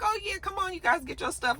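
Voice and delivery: high, soft, sing-song granny; sweet grandma voice. Retold or imitated. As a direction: coo it like a doting grandmother. Grandma voiced agreeing with the kids.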